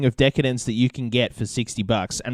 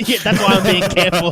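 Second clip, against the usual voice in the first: laughing through the words; 6 semitones higher, 5 dB louder than usual. Real, not acted. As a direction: chuckle while admitting it. Laughing at the Google mishap.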